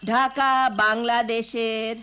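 slow, drawn-out classroom chant; teacher reciting slowly so pupils echo; slow recitation. Shown as a demonstration. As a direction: spell it out slowly for repeating. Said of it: "আমার সাথে সাথে বলো".

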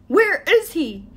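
loud, high-pitched forceful demand. A narrator's reading. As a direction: insist on an answer, raising the voice hard. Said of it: "it demanded".

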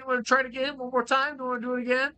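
cocky taunt at the creature; taunting challenge. Real, not acted. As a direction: egg it on to come back. Taunting a monster in game.

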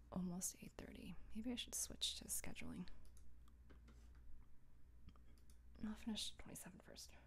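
quick breathy whisper; whispering to self. Real, not acted. Streamer planning her evening to herself, whispered. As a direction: mutter your plans in a whisper.

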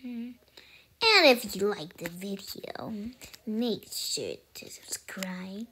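very soft, low whisper; whispered sign-off. Real, not acted. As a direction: whisper the subscribe plea to viewers.

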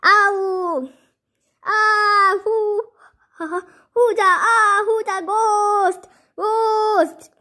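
high, loud panicked yelling; frightened cries. Acted. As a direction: cry out as if seeing a ghost. Frightened by supposed ghost.

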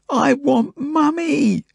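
very high, long-drawn wail; wailing child. A narrator's reading. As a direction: stretch it into a small child's wail. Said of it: "she wails".

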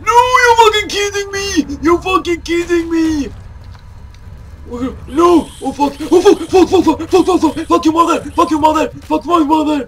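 high loud raging curses; furious shouting. Real, not acted. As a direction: rage at the screen, yelling. Caught again, furious outburst.

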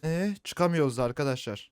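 normal talking voice

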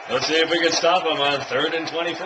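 ordinary delivery

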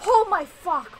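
loud shocked cry; startled shout. Real, not acted. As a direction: yell in sudden fright. Jump scare in horror game.

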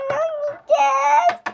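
high, slow whining wail; a daughter whining to her mother; whiny cry. Acted. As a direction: whine like a child on the edge of tears. Child whining to play.

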